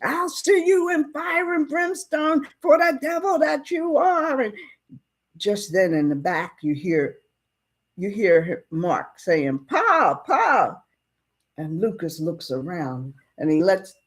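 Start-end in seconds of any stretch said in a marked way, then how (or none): 0.0-4.4 high, shouted curse of fire and brimstone; furious shout. Retold or imitated. Furious father attacks the judge. 9.7-10.8 calling out. Retold or imitated. Boy calling his father.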